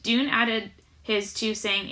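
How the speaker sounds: ordinary delivery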